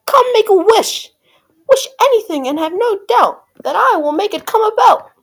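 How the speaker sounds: high, loud magical proclamation; crying fairy. A narrator's reading. Magic fairy offering Cindy a wish, high and loud. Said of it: "she cried".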